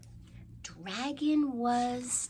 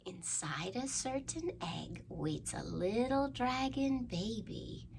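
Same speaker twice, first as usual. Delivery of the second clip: usual speaking voice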